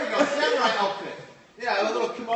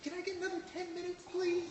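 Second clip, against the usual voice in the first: high, soft, hurried mock plea; small, high pleading voice, imitating own nerves; nervous plea; 12 semitones higher, 7 dB quieter than usual. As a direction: beg meekly for more time. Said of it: "And I would be like".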